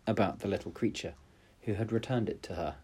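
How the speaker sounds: usual speaking voice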